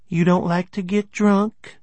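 high, slow tipsy question; tipsy teasing. A narrator's reading. Sloshed woman asking man at bar, teasing. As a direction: ask it high and stretched out.

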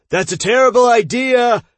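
loud alarmed shout. A narrator's reading. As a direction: yell the warning out. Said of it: "he shouted".

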